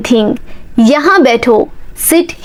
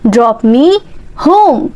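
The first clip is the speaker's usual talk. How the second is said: slow chunked drill; slower than usual. Shown as a demonstration. Teacher drilling learners, slow chunked repeat. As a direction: split for learners to copy.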